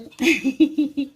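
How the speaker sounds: a loud burst of real laughter; can't stop laughing. Real, not acted. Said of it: "อดขำไม่ได้เลยพี่น้องเอ้ย". (หัวเราะออกมาดังๆ กลั้นไม่อยู่)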